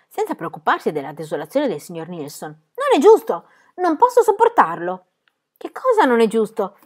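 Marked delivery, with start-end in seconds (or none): 2.5-5.0 higher and much louder outburst; outraged protest. A narrator's reading. Pippi protests loudly to her friends, outraged. 5.5-6.8 higher, louder, wondering question; puzzled surprise. A narrator's reading. Tommy asks Pippi what is unfair, puzzled. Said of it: "chiese meravigliato Tommy".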